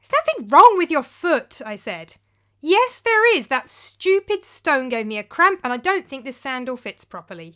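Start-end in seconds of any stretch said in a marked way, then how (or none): 0.0-1.6 higher and louder, impatient snap back; exasperated retort. Acted. 2.6-7.5 much higher and louder at first, then easing; petulant whine. Acted. Philip whining about foot.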